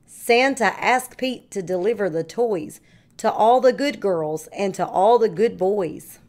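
ordinary delivery

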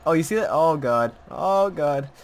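startled and loud; alarmed. Real, not acted. Repeated in alarm.

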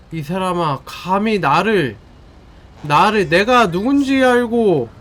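slow, booming god-of-thunder roar; enraged boss roar. Acted. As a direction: roar your name like an angry god. Thor character threatens enemy.

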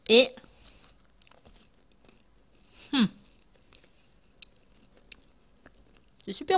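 talking between bites; chewing while talking. Real, not acted. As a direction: mouth partly full. Seen 0: Bites cookie near 4.8s, then speaks while chewing, cookie in hand.

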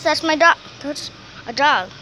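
usual speaking voice

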